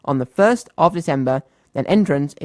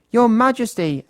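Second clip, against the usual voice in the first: higher, grand herald's announcement; formal announcement; 8 semitones higher than usual. A narrator's reading. Goblin presenting Mister Uppity to the king, mild herald tone. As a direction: present him to the king with ceremony. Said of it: "announced the goblin".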